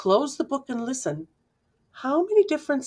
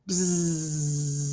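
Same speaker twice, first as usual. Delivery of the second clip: low steady buzz; bee buzz; 5 semitones lower, 3 dB quieter than usual. A narrator's reading. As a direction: hum it like a bee. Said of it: "I hear bees".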